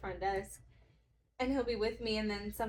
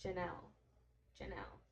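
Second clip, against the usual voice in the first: softer and softer repeated name; repeating her name; 3 semitones lower, 10 dB quieter than usual. Retold or imitated. Testing her own name, fading quiet repetition. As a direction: mutter Janelle to yourself, fading. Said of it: "kept repeating my name over and over".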